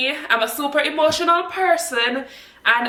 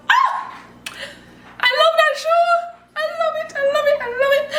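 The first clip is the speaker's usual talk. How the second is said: high squeal, then a much higher "I love it" again and again; excited squeal; faster than usual. Real, not acted. Raving to viewers about a favourite show, in a high fangirl squeal. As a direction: gush like a fangirl who can't contain it.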